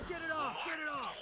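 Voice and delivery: panicked yelling. Real, not acted. Player panicking at imps.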